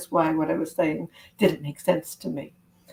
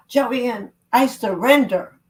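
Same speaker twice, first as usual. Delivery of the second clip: surrendering; 5 semitones higher, 6 dB louder, slower than usual. Shown as a demonstration. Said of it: "the first thing you're gonna do is say".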